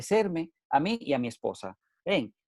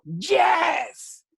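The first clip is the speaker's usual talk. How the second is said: a much higher, loud shouted "yes"; 14 semitones higher, 4 dB louder than usual. Shown as a demonstration. Speaker demonstrating the shout he asks listeners for. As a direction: shout it out as a war cry. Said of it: "grita duro".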